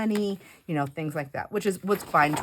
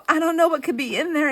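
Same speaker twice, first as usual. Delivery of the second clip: much higher and louder, nervous pleading; panicked protest; 10 semitones higher, 6 dB louder than usual. Retold or imitated. To the TSA agent searching her bag, panicked. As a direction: insist you know nothing, rattled.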